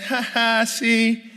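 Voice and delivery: mother laughing and teasing her homesick son; mother laughing at him. Retold or imitated. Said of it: "And my mother starts laughing".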